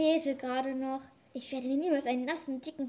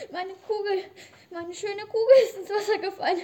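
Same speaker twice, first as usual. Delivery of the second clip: wailing; 5 semitones higher, 7 dB louder than usual. Acted. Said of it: "Wer weint denn hier so schrecklich".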